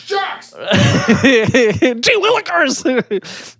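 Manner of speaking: high, slow, hammed-up gee-whiz dad; mock excited dad. Mocking the distracted dad, a high drawn-out cartoon gee-whiz. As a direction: stretch it out like a goofy cartoon dad. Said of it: "'cause the dad's too busy going".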